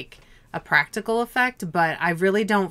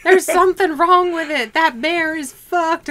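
put-on high, loud panicked kid; scared kid shouting down to the ranger; mock yell; 11 semitones higher, 7 dB louder than usual. Kid in tree yelling warning.

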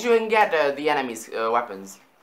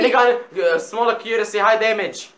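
excited; 7 semitones higher, 7 dB louder than usual. Real, not acted. Excited weapon rundown.

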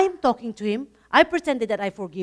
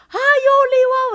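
much higher and louder, a bright happy hello; cheerful greeting; 13 semitones higher, 10 dB louder than usual. Retold or imitated. Retelling how her friend phoned her, gushing happy hello. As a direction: mimic the carefree friend ringing up, all delight.

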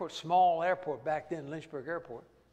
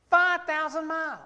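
high and far off, incredulous; aghast repeat; 9 semitones higher, 4 dB louder than usual. Retold or imitated. Young man echoing the distance to Alaska, incredulous. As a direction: say it like it's unthinkable.

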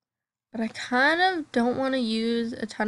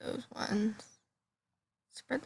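whispering; 9 dB quieter, slower than usual. Real, not acted. Choosing lips, murmured to self.

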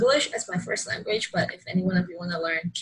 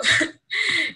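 giggle; 11 semitones higher, 5 dB louder than usual. Real, not acted. Laughs after "hit me up" joke.

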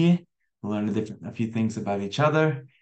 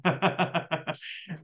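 laugh; 6 semitones higher, 4 dB quieter than usual. Real, not acted. Laughs at own Dora voice.

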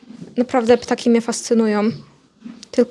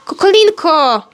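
higher-pitched call to a pet; raised exclaiming call; calling the hen; 5 semitones higher, 4 dB louder, slower than usual. Real, not acted. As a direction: call out the pet's name, drawn to a falling end. Calls hen by name.

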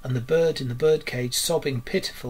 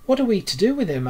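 high, quick, raised question; worried Mole; 8 semitones higher, 6 dB louder, faster than usual. A narrator's reading. Mole asks Rat about Toad, high quick worried voice. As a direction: ask it urgently in a higher voice. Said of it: "asked the mole".

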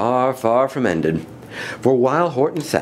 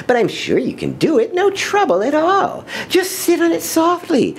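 high, quick, airy bird voice; persuading lazy bird. Acted. Lazy bird persuades the elephant, quick and high. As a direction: rush the persuasion up high and breezy.

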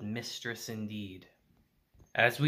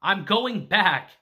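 raised, higher and louder voice; screaming in protest; 5 semitones higher, 6 dB louder than usual. A narrator's reading. Girl objects to abandoning her friend, loud mock scream. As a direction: protest loudly, short of a full scream. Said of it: "she's screaming at him".

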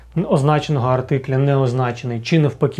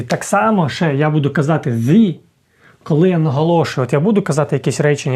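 normal talking voice

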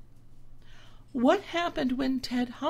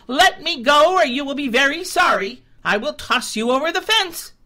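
high, loud and fast, a stuck goat's threat; furious goat threatening; angry threatening cry; 6 semitones higher, 12 dB louder than usual. A narrator's reading. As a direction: bluster to scare him off. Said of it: "cried Ted Billy Goat".

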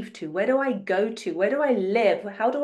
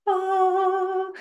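blissful sigh; 10 semitones higher than usual. Real, not acted. Blissful sigh about pure love.

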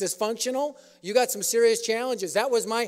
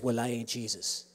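much lower, softer gravel-free growl; low tough-guy reply; 11 semitones lower, 12 dB quieter than usual. Retold or imitated. Uncle Jack answers the floored man, low and deadpan.

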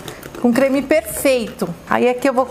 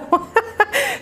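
high, giddy laugh; real laugh of emotion; emotional laugh; 10 semitones higher than usual. Real, not acted. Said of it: "não consigo nem falar de tanta emoção".

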